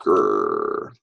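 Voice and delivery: low growl; dog growl. Shown as a demonstration. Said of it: "A dog can say".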